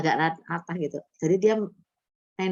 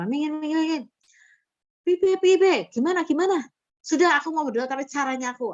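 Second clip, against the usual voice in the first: high-pitched, insistent voice clearing the crowd; bustling church member; 8 semitones higher, 3 dB louder than usual. Retold or imitated. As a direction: act out her taking over, pitched way up.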